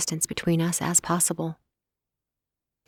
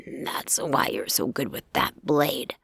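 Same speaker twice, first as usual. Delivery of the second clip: snarled; 4 semitones lower, slower than usual. A narrator's reading. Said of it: "the scarred werewolf snarled".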